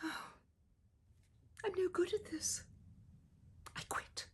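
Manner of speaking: sighing, slow, whispered giving up; defeated sigh. Acted. Girl quitting her invention after hurting her finger, whispered and defeated. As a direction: let it sink out of her.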